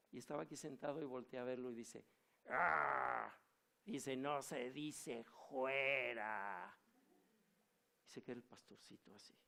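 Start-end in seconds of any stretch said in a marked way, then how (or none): 2.5-3.3 a short, loud growled Ah from the possessed; demonic growl. Retold or imitated. Demon reacts during deliverance. 4.2-6.8 very slow, strange demonic voice. Retold or imitated. Demon corrects the pastor.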